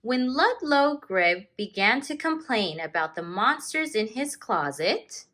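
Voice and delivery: slow, expressive read-aloud with long page-turn pauses; story narration. A narrator's reading. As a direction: read it like a teacher sharing a picture book with the class. Teacher reads picture book aloud.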